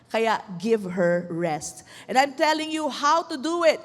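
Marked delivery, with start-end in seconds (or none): 0.1-1.9 slow right down and plead for her; gentle plea. Real, not acted. Preacher asking kids to let nanay rest, gentle plea.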